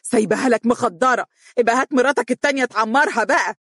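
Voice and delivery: scornful angry parting shot. A narrator's reading. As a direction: hurl it back as she leaves. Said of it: "وهي تقول بغضب".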